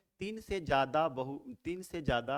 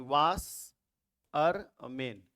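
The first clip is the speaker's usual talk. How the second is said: Washerman slowly broken as वाश, अर, मैन; split into syllables; 4 dB louder, much slower than usual. Shown as a demonstration. Teacher asking the class to notice, washerman in three separate parts. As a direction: pull washerman apart into three drawn-out beats. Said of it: "आप गौर करेंगे".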